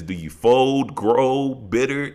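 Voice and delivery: emphatic lyric quote. Retold or imitated. Fan quoting a thought-provoking lyric to co-host, drawn-out emphasis. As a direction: stretch out each choice in the quoted lyric.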